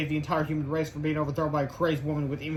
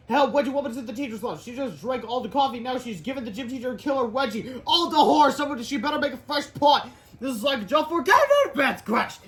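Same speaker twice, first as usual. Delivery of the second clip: high-pitched alarmed comic voice; hammed-up high distress; panicked witness; 8 semitones higher, 3 dB louder than usual. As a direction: raise the alarm melodramatically.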